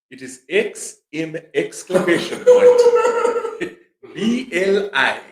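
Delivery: high, slow mock African spelling; mock African accent. Spelling a silly made-up name in a mock African accent. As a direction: spell it slowly in a put-on accent.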